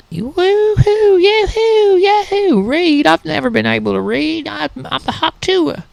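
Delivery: hammed-up shrill yokel whooping yahoo; terrible hillbilly impression. Comedian's over-the-top Hawk Tuah girl impression, high falsetto whoops. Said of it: "So that's my terrible impression".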